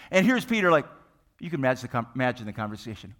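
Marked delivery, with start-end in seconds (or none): none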